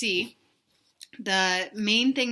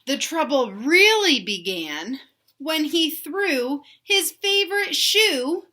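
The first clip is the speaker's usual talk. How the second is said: slow, higher and louder storybook build; reading to children; 7 semitones higher, 7 dB louder, slower than usual. A narrator's reading. Teacher reading Stuck to young kids, big storybook voice. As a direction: lift the line up high to make it dramatic. Teacher reads picture book aloud.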